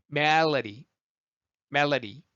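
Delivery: MELODY with stressed MEL and light tapped D; flapped D. Shown as a demonstration. Teacher repeating it for learners, flapped D. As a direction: let the D in MELODY flow. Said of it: "소리가 플랩 돼서".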